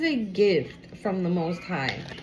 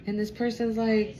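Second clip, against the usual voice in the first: ordinary talk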